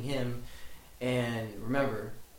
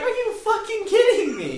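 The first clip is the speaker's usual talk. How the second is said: laughing disbelief; 9 dB louder than usual. Retold or imitated. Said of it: "I'm like".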